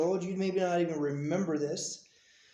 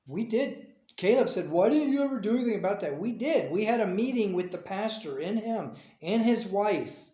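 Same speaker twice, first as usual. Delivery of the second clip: raised, louder, indignant self-justification; defensive insistence; 5 semitones higher, 4 dB louder than usual. Real, not acted. Parent rebuts a charge of never doing anything, defensively. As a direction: push back against the accusation, pitched up.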